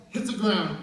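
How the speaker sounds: normal talking voice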